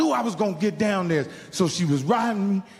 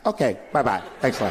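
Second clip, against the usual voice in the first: sugary nice; 8 semitones lower, faster than usual. Retold or imitated. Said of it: "get up there and be nice".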